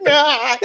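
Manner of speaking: high yelp through laughter; imitated cry of sudden pain; mock pained yelp. Bravo yanked back by his catheter, high mock yelp.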